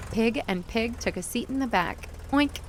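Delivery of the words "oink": oink. A narrator's reading. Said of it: "said Pig and Pig".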